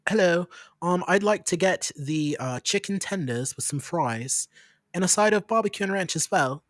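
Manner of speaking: unhurried, polite posh British; put-on posh British accent. Ordering chicken tenders in rural Nebraska, posh British accent. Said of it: "this is how I talk every time".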